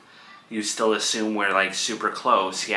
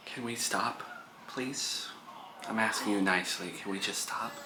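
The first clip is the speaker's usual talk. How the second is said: much softer, weary plea; quiet pleading; 11 dB quieter than usual. Real, not acted. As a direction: beg them to drop it. Mother pleads to end fight.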